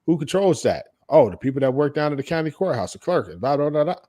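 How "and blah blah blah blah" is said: usual speaking voice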